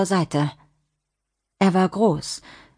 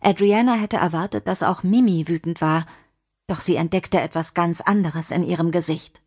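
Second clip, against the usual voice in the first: ordinary delivery, nothing marked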